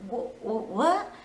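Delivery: stuttering, caught off guard; lightly acted flustered what; mock stammered shock. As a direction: stammer like someone accused out of nowhere. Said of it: "he was like".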